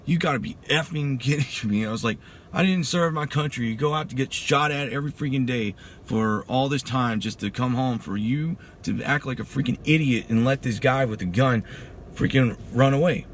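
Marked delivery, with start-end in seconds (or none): 0.0-1.8 high, exasperated retold rant; retelling an indignant outburst; going off on someone. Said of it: "I just went off on this dude". 2.5-13.2 heated retold tirade, pitched up; retelling an angry dressing-down, punching the ends; going off on someone. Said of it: "I just went off on this dude".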